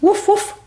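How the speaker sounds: high, short imitated woofs; dog barks. Doggy asking to be next aboard, barking. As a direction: bark up high like an eager puppy. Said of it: "I am a little doggy".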